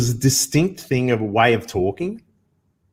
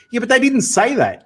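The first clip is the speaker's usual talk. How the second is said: exasperated protest; 11 semitones higher, 3 dB louder than usual. Retold or imitated. Acted protest at spliced TV sentence.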